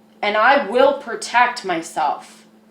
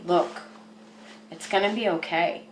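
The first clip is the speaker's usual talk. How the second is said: calming reassurance; 6 semitones lower, 9 dB quieter than usual. Pretend. Calming self after panic.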